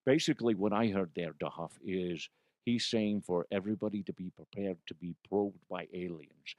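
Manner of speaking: a hint of a second character, Connery, chiming in softly. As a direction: play Connery butting in to give his two cents.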